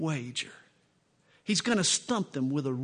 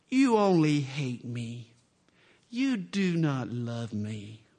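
slow, mock-wounded plea; guilt-trip whine; 4 semitones lower, slower than usual. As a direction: guilt him, slowly. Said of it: "she makes him feel guilty".

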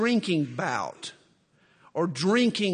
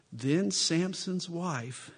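ordinary talk

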